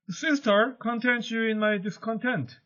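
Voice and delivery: a touch of a resigned younger sister; lightly voiced meek Bianca. Teacher voicing Bianca's line.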